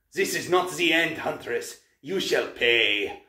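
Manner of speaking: put-on accented villain's vow, slowing at the end; wailing monster. A narrator's reading. Wounded Manticore threatens the huntress, wailing.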